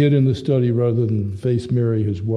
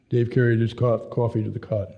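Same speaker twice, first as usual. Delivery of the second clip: ordinary delivery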